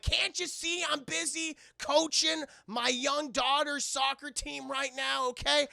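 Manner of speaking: loud, high, slow exasperated yell; annoyed shout. Retold or imitated. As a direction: bellow it at the agent like a busy dad. Rock refusing the agent.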